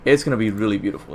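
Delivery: ordinary talk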